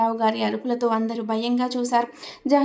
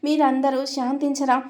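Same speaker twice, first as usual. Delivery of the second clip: ordinary delivery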